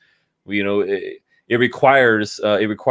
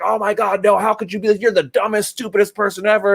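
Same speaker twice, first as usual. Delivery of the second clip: high, mocking sneer at others; 7 semitones higher than usual. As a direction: parody a high-pitched crypto zealot insulting someone. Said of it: "they're just like".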